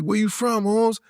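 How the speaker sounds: put-on LA Chicano accent. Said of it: "he like".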